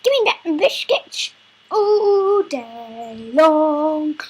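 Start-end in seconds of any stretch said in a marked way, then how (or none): 0.0-1.3 high, loud, fast grumpy grandma; squeaky demanding grandma. Pretend. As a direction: screech like a cross old granny. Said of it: "The grandma on the bus goes".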